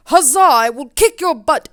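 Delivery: loud, high battle cry; bold battle cry. Retold or imitated. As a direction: shout a cheerful challenge. Marion challenging to fight.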